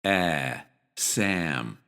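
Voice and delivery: Ah, Sam softly; soft full ah in Sam; full vowel demo. Shown as a demonstration. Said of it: "This is the full pronunciation".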